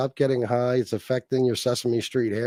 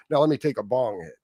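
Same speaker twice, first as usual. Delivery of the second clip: normal talking voice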